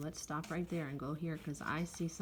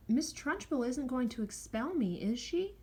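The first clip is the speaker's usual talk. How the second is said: anxious question; 6 semitones higher, 3 dB louder than usual. A narrator's reading. Anxious pupil asks teacher.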